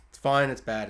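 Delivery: usual speaking voice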